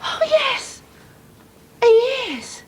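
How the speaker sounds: high, sing-song agreeing; humouring. Acted. Mother humours her daughter, high and sing-song. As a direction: humour the child sweetly.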